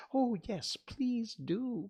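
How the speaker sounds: high, eager plea; pleading. A narrator's reading. Alice begging for a story, pleading. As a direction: beg with a loud, high-pitched lift. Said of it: "pleaded Alice".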